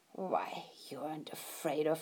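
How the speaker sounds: put-on grandma whispering gently to the child; hushed. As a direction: whisper it like a secret. Grandma teasing quietly.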